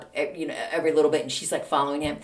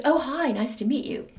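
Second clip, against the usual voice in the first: higher, chirpy imitation of a polite teacher; friendly greeting; 6 semitones higher than usual.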